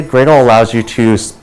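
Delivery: normal talking voice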